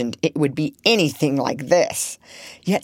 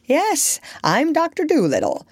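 high-pitched answer, falling at the end; lively reply; 9 semitones higher than usual. A narrator's reading. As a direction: call back brightly in a raised pitch. Answers a shouted question hurrying indoors.

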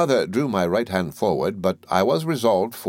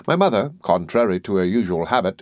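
ordinary delivery

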